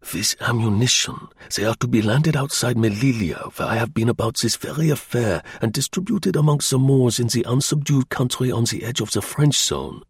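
put-on German-accented, deliberate account; German agent, explaining. German agent explaining the landing to Slingsby, accented and deliberate. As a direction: lay out the scheme, accented.

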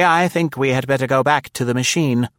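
steady grande dame, firmly practical; calm older lady. A narrator's reading. Nora urging Nancy back to the car, calmly. Nora's voice.

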